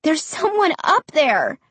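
high, emphatic insistence; insisting. A narrator's reading. Child not believed by Mom, high emphatic insistence. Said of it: "I insisted".

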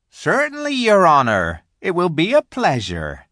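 high, loud country giant; obliging rustic giant. A narrator's reading. Giant agrees to free Aslan's party, obliging. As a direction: answer eagerly and respectfully in a big voice. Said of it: "said the giant Rumblebuffin".

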